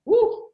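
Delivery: sharp high release of breath; demo exhale whoo. Shown as a demonstration. Coach showing how he releases conflict, high whoo. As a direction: let the tension out with a whoo. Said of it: "the moment I do that".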